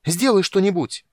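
high, very loud plea; frantic shout. A narrator's reading. Paulin, pointing at the dragon, screams at the dwarf for help. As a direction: scream it in desperation. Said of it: "дико закричал".

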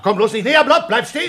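high, panicked plea to keep away; frightened warning. Acted. Mills warns Blob to stay back, frightened. Retreating from knife threat.

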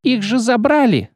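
high, pained squeaky wail; clerk in agony. Acted. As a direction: cry out as if in pain. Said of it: "словно в пароксизме боли".

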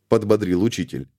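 plain reading voice

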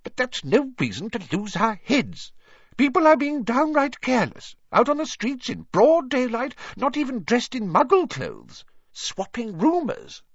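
high-pitched, indignant scolding; irritable. A narrator's reading. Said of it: "said Professor McGonagall irritably".

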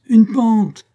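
high, loud outraged echo; indignant scoff. Acted. Corporal scoffing at his wife's warning, loud and indignant. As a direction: throw her word back in disbelief.

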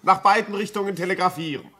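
high, mocking and amused, with a laugh. Doctor scoffing at the frightened signalman, mocking.

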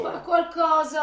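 grido lento e più forte; urlando disperata. Acted. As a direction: pretende aiuto urlando. Lite urlata, lei implora. (urla d'implorazione, lenta)

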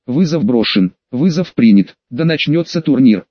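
ordinary delivery

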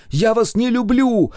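high, loud wail, stress on люблю; wailing scream. A narrator's reading. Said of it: "причитала я".

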